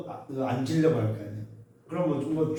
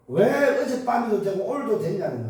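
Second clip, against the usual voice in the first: mock indignant caller, pitched up; higher, heated retelling of a complaint; retold unreasonable complaint; 7 semitones higher, 5 dB louder than usual. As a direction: imitate a caller railing unreasonably. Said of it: "막 막무가내로 막".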